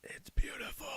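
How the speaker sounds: hushed reverent whisper; breathless whisper of awe; awed whisper. Real, not acted. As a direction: whisper it like it's precious. Admiring the card.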